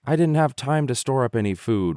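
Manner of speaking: sorry grasshopper whining. A narrator's reading. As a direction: whine it out like a lazy fiddler. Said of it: "whined the grasshopper".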